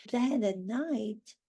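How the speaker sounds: ordinary talk, nothing marked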